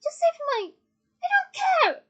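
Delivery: very high, loud, furious outburst; enunciated scream. A narrator's reading. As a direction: scream it but keep each word clear. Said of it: "she screamed".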